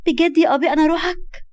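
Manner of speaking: put-on high, delighted girlish question; joyful. Girl delighted to hear she is his soul, joyful. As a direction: ask in thrilled disbelief. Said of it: "نجمة بفرحة وعينيها بتلمع".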